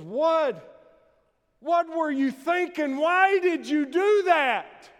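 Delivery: high, loud, indignant demand, slowing on the last question; knocked-down professor demanding an answer from the player; shaken outrage. Acted. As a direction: splutter it out, rattled and furious.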